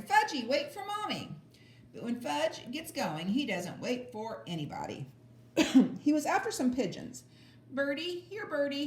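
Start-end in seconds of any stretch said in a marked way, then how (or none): 0.0-1.3 put-on much higher, mother calling after a toddler; hollering mother. A narrator's reading. Mother hollering after Fudge, high and calling. 7.7-8.9 put-on much higher, little boy calling birds; toddler calling. A narrator's reading. Fudge calling pigeons, high toddler voice. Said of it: "he called".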